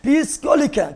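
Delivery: ordinary talk, nothing marked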